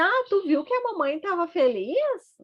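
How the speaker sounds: put-on high, bright baby talk. Shown as a demonstration. Mother to her baby, high cheerful baby talk. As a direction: share the happy moment with the baby. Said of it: "E dizer".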